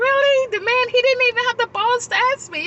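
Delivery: high-pitched, loud retold outrage; indignant disbelief. Speaker reacting to the man who never asked, indignant disbelief.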